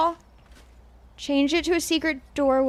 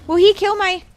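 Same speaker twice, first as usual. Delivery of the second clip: loud, high panicked repeat; 6 semitones higher, 8 dB louder than usual. Real, not acted. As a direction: blurt it out in alarm. Worried about creature in game.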